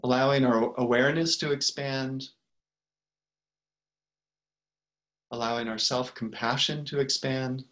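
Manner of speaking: unhurried meditation guide with long pauses; calm, spacious guidance, letting each phrase settle; guided meditation. Real, not acted. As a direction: lead them gently into the breath, phrase by phrase.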